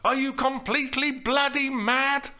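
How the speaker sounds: high, loud outraged tirade; angry high-pitched shouting; furious shout. A narrator's reading. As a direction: yell it in disbelieving fury. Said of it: "she shouted".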